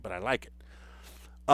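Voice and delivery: whispered aside. Real, not acted. Aside about the name.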